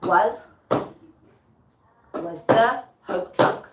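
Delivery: put-on slow, spaced "Was the hope drunk", beat by beat; "Was the hope drunk" in slow metrical chunks; iambic scansion. Shown as a demonstration. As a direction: count out "Was the hope drunk" with deliberate pauses. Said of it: "let's listen to the iambic".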